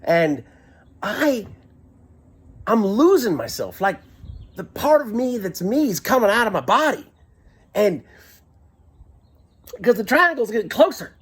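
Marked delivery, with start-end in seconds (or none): none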